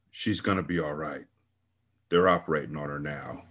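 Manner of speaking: numb, drained reassurance; flat. Acted. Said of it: "he said flatly".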